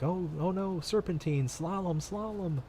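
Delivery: high, squeaky panicky slalom chant; panicked movie quote. Retold or imitated. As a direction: squeal it like a cartoon character. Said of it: "I'm having to sit there and be like".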